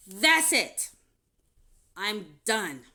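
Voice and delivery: loud, final outburst; snapping. Retold or imitated. Telling her ex-husband she is done, loud outburst. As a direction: blurt it out loud, fed up. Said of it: "and then I snapped".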